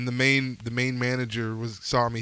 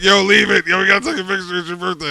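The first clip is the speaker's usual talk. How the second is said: loud party yell; shouting friends; 6 semitones higher, 10 dB louder than usual. Retold or imitated. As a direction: call out across the room.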